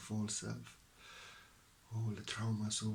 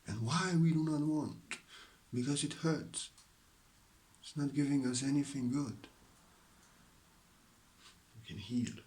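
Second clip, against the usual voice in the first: ordinary delivery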